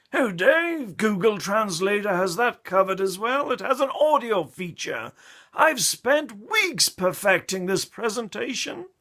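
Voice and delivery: boastful colleague. Retold or imitated. Colleague boasting to Dave about translation, hammed-up high voice. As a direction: crow over a clever trick.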